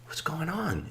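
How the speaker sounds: high soft hushed question; old man softly asking the drunk what's wrong; gentle concern. Retold or imitated. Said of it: "He's like".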